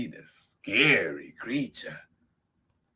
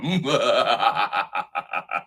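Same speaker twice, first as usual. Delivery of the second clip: loud, rolling monster laugh; hammed-up belly laugh, tailing off; bursting with laughter; 2 semitones higher, 8 dB louder than usual. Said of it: "bursting with laughter".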